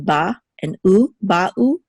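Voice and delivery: Ba'u with Ba and U held apart; separated syllables. Shown as a demonstration. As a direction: leave a small gap inside Ba'u. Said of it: "there should be a separation".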